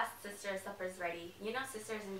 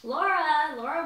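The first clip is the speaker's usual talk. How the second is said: loud, higher call across the house; 6 semitones higher, 8 dB louder, slower than usual. Acted. Mother calling Laura to the table again. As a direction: summon her firmly.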